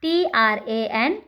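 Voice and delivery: letters spelled one by one, slowly; slow spelling out. Shown as a demonstration. Teacher spelling a word slowly for students. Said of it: "next है".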